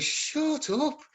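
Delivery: acted rallying voice that has had enough; sick and tired. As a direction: cut in on the pity, exasperated. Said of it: "absolutely sick and tired of listening".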